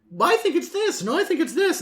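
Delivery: high, hammed-up imitation of friends bickering over a rule; mocking bickering players. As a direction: squeak out both sides of a petty rules argument. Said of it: "they would be like".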